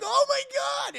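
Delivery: high, loud mock scream; hammed-up victim panic; terrified shriek. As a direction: shriek in horror. Victim panics in retold scene.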